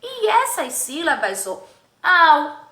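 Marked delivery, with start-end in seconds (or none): none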